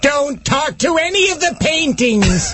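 high, sing-song unhinged villain; crazy Vincent Price voice. Retold or imitated. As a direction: let the madness leap up in pitch. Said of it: "and the crazy voice".

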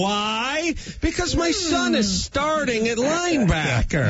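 high boastful dad; proud dad voice. Retold or imitated. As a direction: keep bragging as the proud father. Continues imitating the proud dad.

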